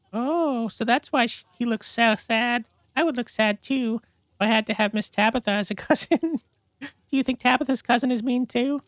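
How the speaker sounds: put-on very high, slow small-child voice; little child voice. Young child asking mommy about the stranger, very high voice. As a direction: ask like a curious toddler.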